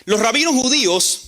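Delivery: ordinary talk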